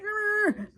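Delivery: mock dog growl; playful little growl like an angry pup; high mock snarl. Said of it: "He's like".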